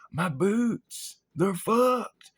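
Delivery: high-pitched wail of dismay; hammed-up heartbroken whine; acted dismay. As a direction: lament it in a high voice. Acting dismay at burnt boots.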